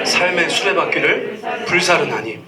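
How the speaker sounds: slow, solemn communal recitation; scripture reading. Real, not acted. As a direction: read it together, weighty and slow. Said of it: "우리 같이 한번 읽어보기 원합니다". (성경을 느리게 함께 낭독하는 어조)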